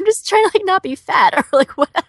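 high giggly voice; laughing. Real, not acted. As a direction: giggle through the words. Laughing self-deprecation.

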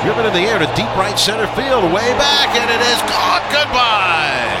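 excited play-by-play, rising pitch; home run call. Acted. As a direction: shout it out as it leaves.

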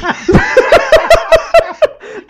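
laughing hard, pitch shooting up; a real helpless peal of laughter; high burst of laughter. Real, not acted.

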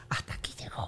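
breathy whispered aside; hushed dramatic whisper. Retold or imitated. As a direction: drop to a whisper for the last straw. Retelling the final fight.

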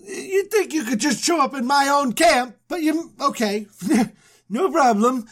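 high, put-upon sarcasm with a laugh; complain, then give in; sarcastic grumble. Retold or imitated. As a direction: Abram grumbling at God's demand.

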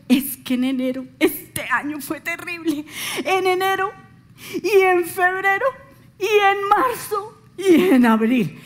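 mock whining voice, rising high on each month; imitating someone who moans about every month; whiny complainer. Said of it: "me dijo".

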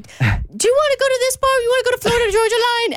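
high, fast doggy voice. Retold or imitated. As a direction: talk up high like a pet dog. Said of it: "speaking like this with other people around".

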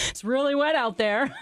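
high-pitched forced cackling through the line; mock the phony TV banter, laughing hard; exaggerated anchor chuckles around a lame quip; fake morning-show laughter. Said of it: "someone says something and they all go".

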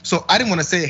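ordinary delivery

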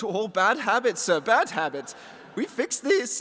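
theatrical mock German scolding; tut-tut in a put-on German accent; German Willy Wonka. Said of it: "like, a German Willy Wonka".

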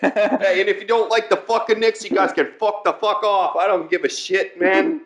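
hammed-up hostile Knicks fan from New York; mock New York accent. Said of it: "New York, it's pretty much all the same".

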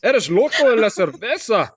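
high-pitched, hammed-up Scottish accent on Spanish; exaggerated Scottish-accented Spanish. Shown as a demonstration. Host repeats the Spanish line on request, hammed-up high Scottish accent. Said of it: "in Spanish in a Scottish accent".

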